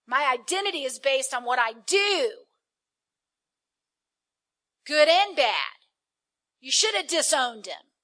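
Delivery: indignant. Acted. Preacher voicing elder son's grievance.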